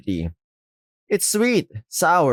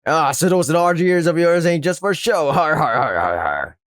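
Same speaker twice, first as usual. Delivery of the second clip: mocking pirate with a har-har laugh; hammed-up pirate chortle; pirate act; 3 semitones higher than usual. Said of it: "Whatever this pirate act is supposed to be".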